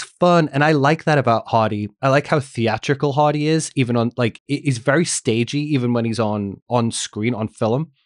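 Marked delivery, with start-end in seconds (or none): none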